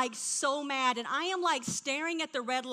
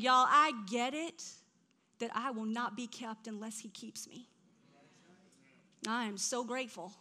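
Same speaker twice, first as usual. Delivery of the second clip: normal talking voice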